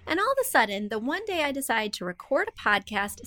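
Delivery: usual speaking voice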